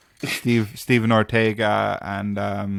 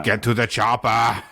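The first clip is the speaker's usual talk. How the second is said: Arnie impression; slower than usual. Arnie quote in chat.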